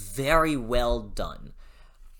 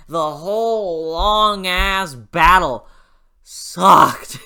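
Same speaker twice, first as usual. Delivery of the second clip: mock rant; 5 semitones higher, 10 dB louder, much slower than usual. Real, not acted. Ranting about the fight scene.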